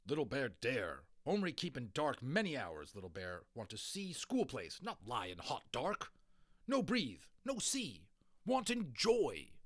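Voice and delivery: indignant little warrior complaining; defiant tiny warrior voice; Little Bear's voice. A narrator's reading. Little Bear's broken-English complaint.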